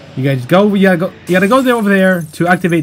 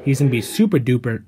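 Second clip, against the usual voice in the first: normal talking voice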